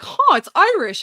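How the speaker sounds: mocking Kyle as he spots the flyer, loud and dopey; mock dumb discovery. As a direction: ham up a clueless teen noticing the obvious. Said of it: "he's like".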